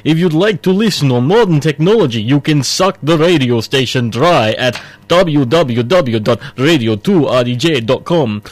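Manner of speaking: hammy Transylvanian vampire drawl; bad Russian accent. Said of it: "since I can't keep that voice up".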